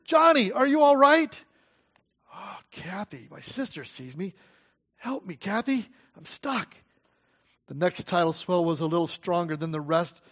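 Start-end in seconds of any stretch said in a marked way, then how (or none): 0.1-1.4 much higher and louder, calling to her sister; anxious sister calling out; worried call. A narrator's reading. Sister calls out, getting closer. 2.3-6.7 much softer, breathless and scared; frightened, barely audible call for help; faint desperate plea. A narrator's reading. Trapped girl pleads to sister.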